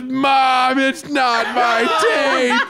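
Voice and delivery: pleading yell. Retold or imitated. Caught son yelling after his mother, mock desperate. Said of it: "And Chris goes".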